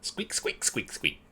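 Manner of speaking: breathy rat squeaks; rubbery high squeaky toy. Acted. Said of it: "Squeak will make the sound of rats".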